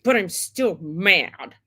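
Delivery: put-on slow, sulky little-kid whine; a hint of a sulky child, slow and pouting; sulky inner child. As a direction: pout like a stubborn small child. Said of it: "reactive part of you is like".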